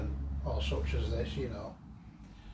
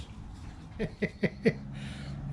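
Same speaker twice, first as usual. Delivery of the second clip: breathless laugh; 7 semitones higher, 6 dB quieter than usual. Real, not acted. Laughing at the smoky house.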